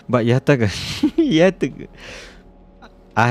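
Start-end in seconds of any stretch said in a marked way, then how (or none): 0.0-1.9 amused, breaking into laughter on the name; laughing. Real, not acted. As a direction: laugh through the strange name, pitch jumping up on the repeat. Laughs while attempting the odd name.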